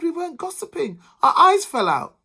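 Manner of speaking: high defensive protest; protesting women. A narrator's reading. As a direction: insist on the truth.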